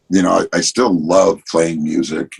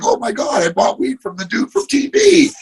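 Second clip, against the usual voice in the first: imitating a thrilled fan.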